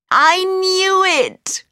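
put-on high, drawn-out old man's cry; triumphant cry. Old man thinks he recognises the boy, triumphant cry. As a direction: cry out in delight, pitch soaring. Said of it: "cried the old man".

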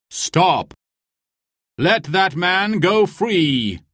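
high, drawn-out commanding call; crying out a command. A narrator's reading. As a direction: call out the order slowly and high. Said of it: "cried a voice".

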